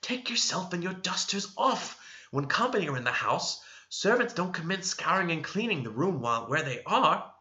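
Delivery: a servant scolded in a sharp undertone; cross whisper. A narrator's reading. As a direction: hiss it at her, annoyed. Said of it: "whispered crossly".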